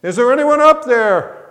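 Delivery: high, loud desperate call upward; calling out to heaven. Acted. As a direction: shout up for help, terrified. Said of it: "he called out to heaven".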